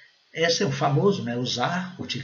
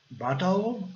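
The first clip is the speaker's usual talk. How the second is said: higher "Bottle" with a crisp T; British "Bottle", rounded O and sounded T; British pronunciation; 6 semitones higher than usual. Shown as a demonstration. Teacher demonstrates how Britons say it, British accent. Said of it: "os ingleses britânicos falam bottle".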